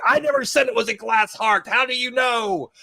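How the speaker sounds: high, triumphant accusation, lightly acted; imagined Felicity gotcha. Felicity catching the doctor's slip, high triumphant. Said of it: "expecting Felicity to jump like".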